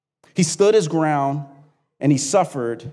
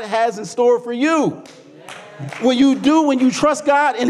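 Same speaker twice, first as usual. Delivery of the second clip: high, ringing sermon climax; impassioned preaching; 9 semitones higher than usual. Real, not acted. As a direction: preach it high and forceful, stretching key phrases. Preaches at full force.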